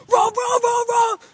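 loud, high barking "Rob, Rob"; loud high dog bark voice; dog barking as words. Retold or imitated. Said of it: "a dog goes".

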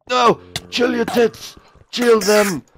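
shrieking high and loud. Real, not acted. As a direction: scream it in panic. Yelling at mob.

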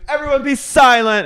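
high, loud mock-imperious yell; commanding shout. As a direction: act out silencing the whole room. Retold command to brawling group.

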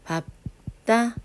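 밥다 split as 밥, pause, 따; demonstrated pronunciation. Shown as a demonstration. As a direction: stop on 밥 before releasing 따. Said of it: "이렇게 발음하지".

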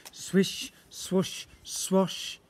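airy swooshing words; swishing whale tail sounds; swishing sound effect. A narrator's reading.

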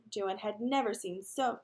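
ordinary delivery

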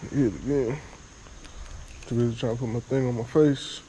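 ordinary delivery

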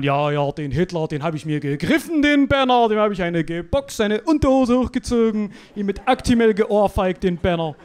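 loud mock-boasting old man; boasting old witness. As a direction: brag it, loud and proud. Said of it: "Scheiße labern". (prahlender Zeitzeuge, laut)

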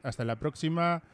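usual speaking voice

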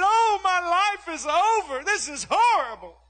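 high, drawn-out melodramatic wail; hammed-up tearful daughter; mock bawling. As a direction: imitate a child's overblown despair, slow and high. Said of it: "she cried and she bawled".

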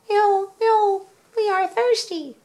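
very high, loud mewing cat voices; whiny chorus of thirsty kittens; crying cats. A narrator's reading. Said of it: "cried the hundreds of cats".

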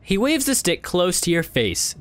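ordinary delivery

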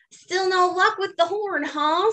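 a friend rubbing it in; Bob teasing. Acted. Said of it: "says Bob".

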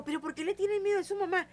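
high pleading reproach; reproachful. Retold or imitated. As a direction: chide them for fearing their mother. Regaña a las hijas. (reproche agudo)

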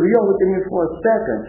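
normal talking voice

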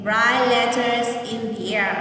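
"Write letters in the air" said slowly as one joined phrase; slow whole phrase. Shown as a demonstration. Teacher reading the whole phrase slowly for children. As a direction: join the words together, slowly. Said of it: "Nghe cô đọc lại lần nữa nhé".

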